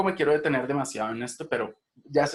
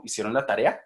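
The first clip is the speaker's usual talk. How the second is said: TAREA lifts slightly at the end; yes-no question, gentle rise on "tarea"; rising question; 3 dB louder than usual. Shown as a demonstration. Teacher showing students a yes-no question, rising intonation. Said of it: "una leve entonación ascendente".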